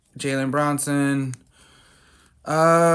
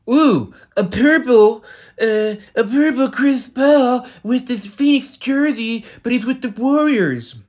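much higher, louder, thrilled ooh; excited; 9 semitones higher, 5 dB louder than usual. Real, not acted. Pulling a rare purple Chris Paul card, excited. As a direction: gush over a rare card.

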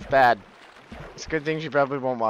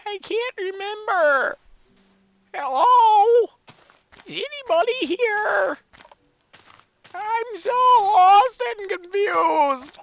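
put-on high, plaintive lost-boy voice; whiny lost character; 14 semitones higher than usual. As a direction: whimper your way through the jungle. Plays lost character in jungle.

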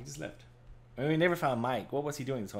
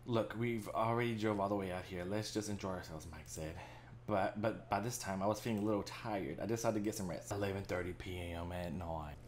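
story narration; 2 semitones lower, 5 dB quieter than usual. Acted. Reads game narration.

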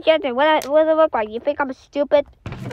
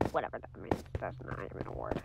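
fast, whispered grumble; quick whispered gripe; whispered aside; 19 dB quieter, faster than usual. Real, not acted. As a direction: mutter it quickly under your breath. Muttering about word sus.

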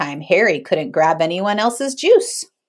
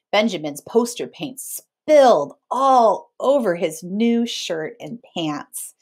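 ordinary delivery

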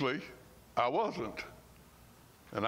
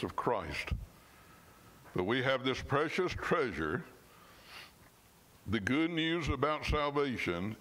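scripture reading; 3 semitones lower than usual. Acted. Preacher reading Romans to his congregation, measured and earnest. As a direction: lean on each phrase as you read the verse.

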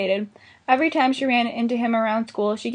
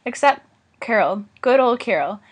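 ordinary delivery

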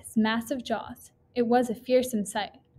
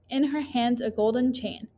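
plain reading voice

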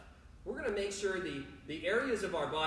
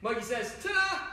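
rapid call with a high, louder final word; faster game call; 4 semitones higher, 3 dB louder than usual. Shown as a demonstration. Coach racing for a speed badge, faster call. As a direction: rush it and pop the last word up high. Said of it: "do it a little bit faster".

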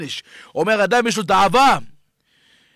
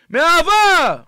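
exclaimed; 6 semitones higher, much slower than usual. Real, not acted. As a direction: belt out the word. Preacher declaring rebuke is love.

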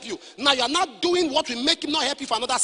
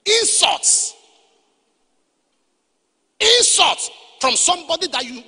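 preaching shout; 6 semitones higher, 6 dB louder, slower than usual. Real, not acted. Preacher denouncing insults.

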